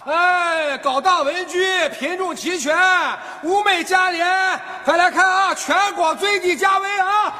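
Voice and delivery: street vendor's pitch. Acted. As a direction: call out the bargains in a steady chant. Vendor hawking wares to passers-by.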